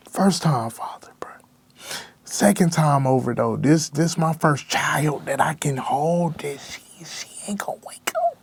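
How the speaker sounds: barely getting it out through tears; crying whisper. Real, not acted. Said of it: "but I ended up crying".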